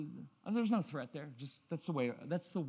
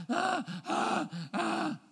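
1 high protest whine; 13 semitones higher, much slower than usual. Retold or imitated. Imitating kid protest noise.

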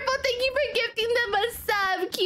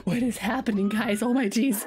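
normal talking voice

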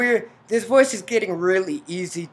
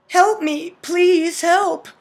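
higher, louder cry for help; desperate plea; 11 semitones higher, 8 dB louder, slower than usual. Acted. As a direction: act out begging someone to come. Heroine cries out for help.

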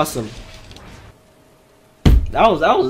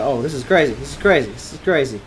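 ordinary talk, nothing marked

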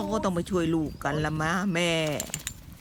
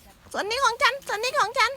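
high, slow squeaky animal voice; cute high voice; 13 semitones higher than usual. Acted. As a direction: voice a tiny critter slowly. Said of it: "แม่บอก".

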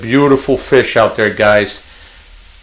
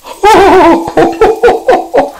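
high-pitched mock Santa ho-ho-ho; Santa laugh. Cook pouring drippings on top, mock Santa laugh. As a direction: hoot out a long run of ho-hos. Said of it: "It's not Christmas yet".

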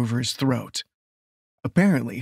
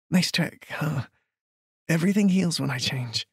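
breathless; 3 semitones higher than usual. Acted. Healed woman to her frightened lover, breathless. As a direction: toss it off, a little out of breath. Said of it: "She laughed breathlessly".

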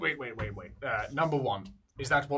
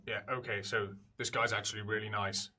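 ordinary talk, nothing marked

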